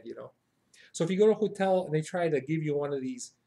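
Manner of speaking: ordinary talk, nothing marked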